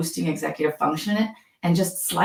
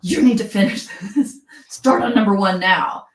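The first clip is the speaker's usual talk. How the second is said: mock-stern order with a slight laugh; threatening demand; 4 dB louder than usual. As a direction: play a pushy teacher pressing hard.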